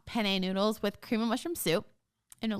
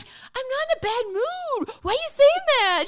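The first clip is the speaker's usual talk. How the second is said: much higher mock protest, louder at the end; indignant Abby; 6 dB louder than usual. Crabby Abby denying her bad mood, high indignant imitation. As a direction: mimic her high, defensive objection, building in volume. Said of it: "And she's like".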